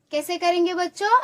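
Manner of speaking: ordinary talk, nothing marked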